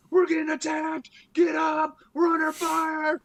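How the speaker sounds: high, loud mock alarm yelling; alarm shout. XO rousing sleeping Marines, high loud alarm shout. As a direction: yell the false alarm to wake everyone.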